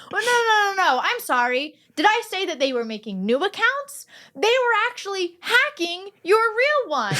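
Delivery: a panicky caller scrambling to fix his lie; hammed-up flustered scammer. The scammer backtracks on the phone to Rebecca, mock panic, high and loud.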